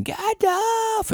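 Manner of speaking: very high anguished cry of a name. Retold or imitated. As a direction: wail the name in despair. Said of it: "Frodo's, like, crying, going".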